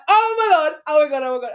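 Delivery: high, loud panicked gasping exclamations; retold panic. Host retelling seeing a guy at the gate, mock panic. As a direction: reenact alarm rising in a rush of repeats.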